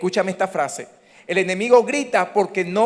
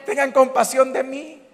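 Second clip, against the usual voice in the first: high, louder mock pleading; playful plea; 8 semitones higher, 6 dB louder than usual. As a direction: beg the crowd for pity, jokingly. Comic plea to congregation.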